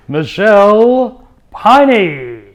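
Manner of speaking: slow, loud, high host announcement; grand announcer. Acted. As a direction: draw the name out like a showman. Announcing the guest.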